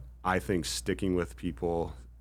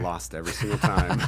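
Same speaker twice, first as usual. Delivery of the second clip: laughing through the words, higher and louder; laughing admission; 4 semitones higher, 7 dB louder than usual. Real, not acted. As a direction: break into a laugh as you admit it. Joking about losing to rivals.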